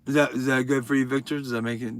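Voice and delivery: ordinary delivery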